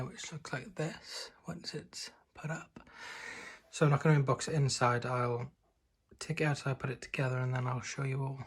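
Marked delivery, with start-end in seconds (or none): 0.0-2.8 soft unvoiced whisper. Real, not acted. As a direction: whisper it slowly. Showing the new chimney.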